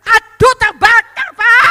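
high, loud, drawn-out cry; alarmed shout. Retold or imitated. Wife telling husband the kitchen burns, loud cry. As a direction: yell for help as the fire spreads.

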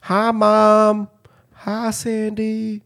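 put-on high-pitched Midwestern farm kid; mock Midwest kid. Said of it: "some little shithead kid from the Midwest".

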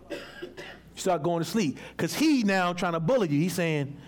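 ordinary delivery

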